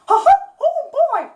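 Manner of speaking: high squeaky cheerful mouse; Mickey Mouse impression. Shown as a demonstration. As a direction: chirp it like the cartoon mouse. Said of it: "not the most professional impression".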